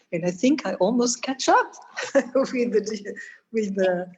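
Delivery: giggly apology for running late; laughing. Real, not acted. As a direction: laugh it out, a bit embarrassed. Speaker laughs at running over time.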